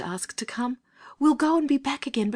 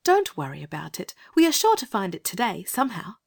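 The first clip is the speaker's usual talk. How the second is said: put-on high, whispery girl's voice; gentle, breathy child voice; gentle reassurance; faster than usual. A narrator's reading. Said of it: "said Anthea gently".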